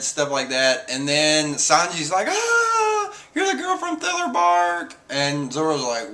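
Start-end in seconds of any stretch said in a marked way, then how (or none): 2.3-4.9 high mock squeal of recognition; excited recognition. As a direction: gasp it out high, imitating him. Acted quote of Sanji recognising a girl.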